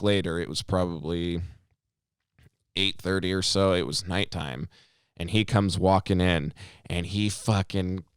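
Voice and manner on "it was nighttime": ordinary talk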